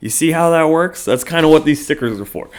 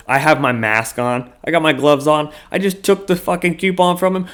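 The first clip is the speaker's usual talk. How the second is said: ordinary talk, nothing marked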